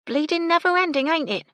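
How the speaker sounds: high, loud cockney grumble; grumbling cockney maid. A narrator's reading. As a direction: moan about endless work. Gertie grumbling.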